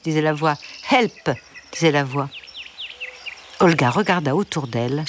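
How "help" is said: a short urgent duck call for "Help"; nasal duck cry. A narrator's reading. Duck begging Olga for help, higher nasal cry. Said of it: "une voix nasillarde".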